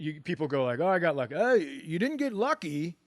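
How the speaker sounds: ordinary delivery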